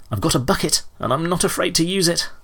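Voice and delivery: high-pitched, scared bluster; frightened defiance. A narrator's reading. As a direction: threaten in a high, panicky voice. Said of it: "he cried".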